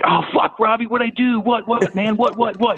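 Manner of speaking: high, rapid panicky questions; panicked pleading. Retold or imitated. Panicked reply to coach.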